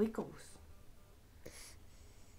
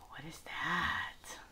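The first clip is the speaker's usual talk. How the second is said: whispered gasp; 11 semitones lower, 5 dB quieter than usual. Real, not acted. Gasp then whisper to toddler.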